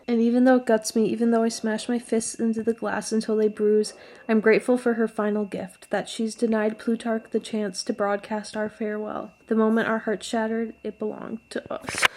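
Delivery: book passage read with feeling; dramatic reading of a first-person novel; reading aloud. Acted.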